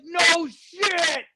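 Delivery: high-pitched exasperated yell back; retold shouted retort. Shouted reply under fire.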